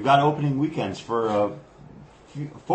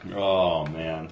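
normal talking voice

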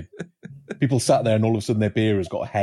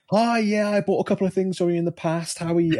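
bright, much higher, eager hello to a stranger; chirpy greeting; 10 semitones higher than usual. Retold or imitated. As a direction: play yourself overly friendly and high. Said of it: "I was like".

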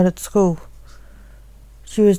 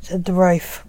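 ordinary delivery

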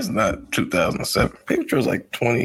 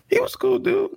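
falsetto. Acted. Joking high falsetto remark.

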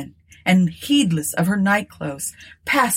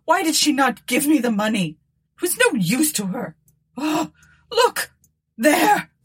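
high-pitched frantic wail; screamed confession; 8 semitones higher than usual. A narrator's reading. Miserable woman confesses and sees a ghost, screaming. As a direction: pour out guilt, then shriek in terror. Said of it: "screamed the miserable woman".

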